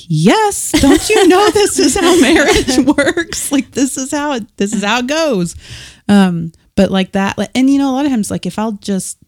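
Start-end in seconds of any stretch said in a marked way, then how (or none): 0.1-5.5 laughing mock defiance. Telling her friend about marriage, laughing and high. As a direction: laugh off the obvious with mock sass. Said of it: "I'm like".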